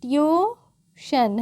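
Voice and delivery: Tuition stretched very slowly as "tyooo... shun"; drawn-out syllables. Shown as a demonstration. Teacher showing where श sounds, slow syllable-by-syllable tuition. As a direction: split tuition in two, holding "tyoo" before "shun". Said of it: "तो क्या होगी".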